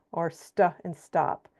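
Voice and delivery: ordinary delivery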